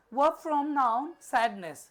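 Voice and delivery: slow, loud, spelled-out question read; teacher announces the question to the class; reading the question aloud. Shown as a demonstration. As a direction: read "verb from noun sadness" slowly and loudly. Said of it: "reading the question".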